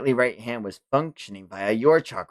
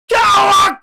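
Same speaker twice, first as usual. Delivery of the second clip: much higher, louder raging yell of the name; furious scream; 10 dB louder than usual. Acted. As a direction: shriek the name across the battlefield. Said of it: "he screams".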